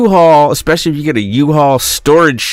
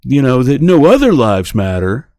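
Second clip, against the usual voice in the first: higher, put-on voice of protest; mocking objectors; 5 semitones higher, 3 dB louder than usual. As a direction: mimic people explaining themselves, pitched up. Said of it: "People say".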